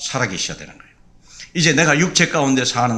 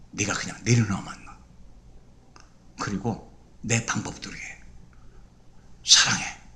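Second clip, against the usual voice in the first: slow, soft, lower whisper; whispered voice of the Lord; 10 semitones lower, 8 dB quieter, slower than usual. Acted. Jesus reassures the hurting wife, slow tender whisper. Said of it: "아니라는 거예요".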